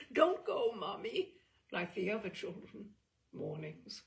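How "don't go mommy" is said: put-on imploring child. A narrator's reading. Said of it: "clutch and implore".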